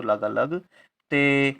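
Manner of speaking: लगा लगते drawn out very slowly; slow sounding out. Shown as a demonstration. Teacher assembling लगते for pupils, very slow. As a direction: assemble the word aloud, stretching it. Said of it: "गा के साथ कोई उच्चारण नहीं".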